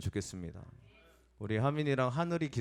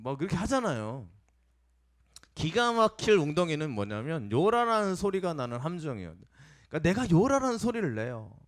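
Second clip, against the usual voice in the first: raised, forceful pulpit voice; impassioned preaching, higher and louder; preaching at full force; 5 semitones higher, 4 dB louder than usual. Acted. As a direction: drive the point home to the congregation. Preacher heats up the sermon.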